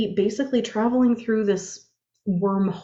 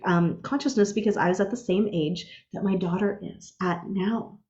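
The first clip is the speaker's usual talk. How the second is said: ordinary delivery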